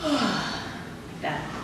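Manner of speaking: a long breathy yawning sigh; full yawn demo. Shown as a demonstration. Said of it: "So it's gonna look like this".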